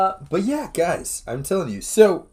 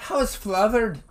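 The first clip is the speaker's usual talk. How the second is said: a higher mock Irish flothered; Irish accent; 7 semitones higher than usual. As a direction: try the word like a local. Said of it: "we're in full Irish".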